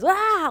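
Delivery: very high, loud mock yell; imitated child's grunt. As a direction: yelp like a demanding toddler. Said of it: "But when he goes".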